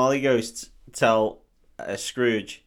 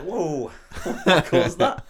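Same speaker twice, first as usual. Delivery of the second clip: higher, drawn-out "whoa" breaking into a laugh; startled mock quote; 6 semitones higher, slower than usual. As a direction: act mildly startled, then laugh. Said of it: "And he's like".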